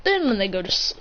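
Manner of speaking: ordinary delivery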